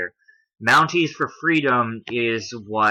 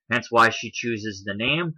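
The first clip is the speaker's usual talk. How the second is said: ordinary talk, nothing marked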